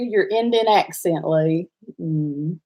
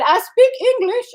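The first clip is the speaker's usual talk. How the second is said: high squeaky mock voice; retelling a caller's words, pitched way up; quoting the caller, pitched up; 14 semitones higher than usual. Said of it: "And he said".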